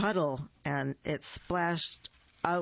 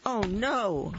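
startled cry; 4 semitones higher, 3 dB louder than usual. Acted. Dog arfing at bird.

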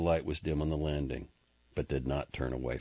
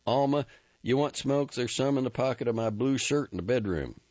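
higher, louder call down the stairs; hurried shout to the wife upstairs; called back; 9 semitones higher, 4 dB louder than usual. Acted. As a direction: call back over the shoulder while leaving. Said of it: "he called back".

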